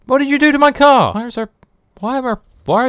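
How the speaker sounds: high shocked question; startled outrage. Real, not acted. Player seeing his car messed up, high startled cry. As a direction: blurt it out in disbelief.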